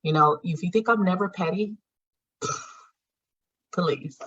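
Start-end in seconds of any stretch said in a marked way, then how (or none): 2.4-4.2 scoffing sigh. Real, not acted. Dismissing idea she's never petty.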